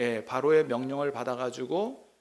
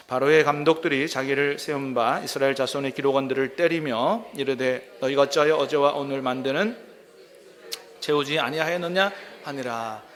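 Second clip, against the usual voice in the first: louder, measured Bible reading voice; scripture reading; 4 dB louder than usual. Acted. Pastor reading scripture to the congregation, formal and measured. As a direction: read the verse out to the congregation.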